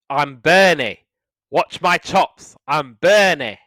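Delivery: put-on loud northern English accent, slowed on watch my chops. [name] mocking Bernie, shouting it in a northern accent. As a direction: bellow it in a broad northern accent. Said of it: "In that northern accent of his".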